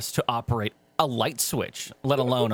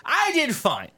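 boastful take; 13 semitones higher, slower than usual. Shown as a demonstration. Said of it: "Here we go".

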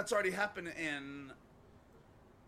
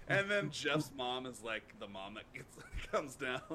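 ordinary talk

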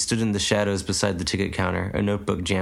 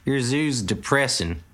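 put-on old farmer; 4 semitones higher, slower than usual. A narrator's reading. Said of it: "added the old man".

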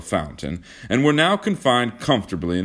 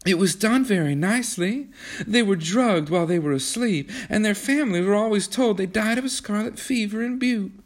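a hint of a higher, calm girl; lightly voiced Kismine; 14 semitones higher than usual. A narrator's reading. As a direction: explain it matter-of-factly, higher. Kismine calmly explains.

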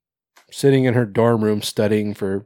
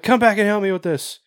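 calling out; 9 semitones higher than usual. Retold or imitated. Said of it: "he's like".

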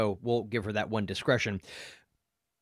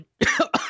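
sudden high sputtering cough; coughing on a sip of tea; choking cough; 4 dB louder than usual. Real, not acted. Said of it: "I'm choking on tea".

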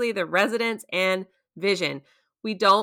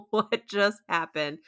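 slow, stunned disbelief; open-mouthed shock; 3 dB quieter, much slower than usual. Retold or imitated. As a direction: drag it out, jaw hanging in shock. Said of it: "sitting there with my mouth open like".

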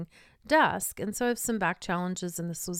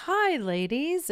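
high, slow and beaming hello; pleasant, expansive greeting; 8 semitones higher, 3 dB louder, slower than usual. Retold or imitated. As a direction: greet them with big, joyful warmth. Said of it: "very pleasant and very expansive".

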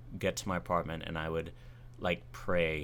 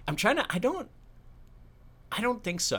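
flustered. Real, not acted. Caught off guard by question.

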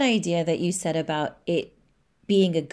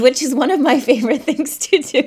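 laughing; 9 semitones higher, 8 dB louder than usual. Real, not acted. Laughs sharing favorite thing.

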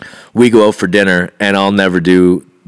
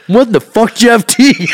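high-pitched mock disbelief; comic high indignation; incredulous retold outburst; 12 semitones higher than usual. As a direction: imitate the husband demanding an answer. Shocked husband challenges mailman.

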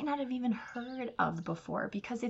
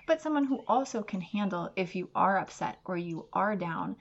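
usual speaking voice

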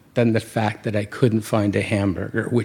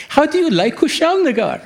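high, bright-eyed proud townsperson; much higher, proud local asking a foreign visitor; beaming local. Retold or imitated. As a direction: play the beaming local showing off his town. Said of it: "would go".

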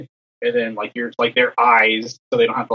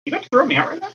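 very high, incredulous squeak; acted-out incredulity, voice shooting way up; disbelief; much faster than usual. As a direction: question it as if you can't believe it. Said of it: "I was just in disbelief".

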